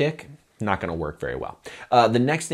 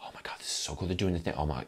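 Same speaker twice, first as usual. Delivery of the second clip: whispered, breathless excitement; excited whisper; 3 semitones lower, 11 dB quieter, faster than usual. Retold or imitated. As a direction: gush under your breath. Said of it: "enjoying like".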